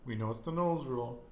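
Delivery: usual speaking voice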